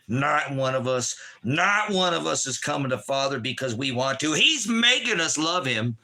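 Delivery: shouting preacher warning of judgment; fire-and-brimstone preaching. Acted. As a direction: thunder the woe at the crowd. Preaching again at full force.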